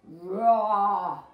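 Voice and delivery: loud mock tiger roar for a child. Reading to a child, a loud playful tiger roar. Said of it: "the tiger liked to roar".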